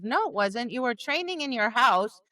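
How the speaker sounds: ordinary talk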